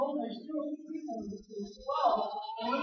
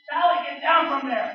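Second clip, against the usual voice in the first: loud alarmed yell, much louder; dad yelling; 3 semitones higher, 11 dB louder than usual. Retold or imitated. Dad yells at his climbing daughter, loud alarmed shout. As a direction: shout it like a worried father. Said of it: "and her dad yells".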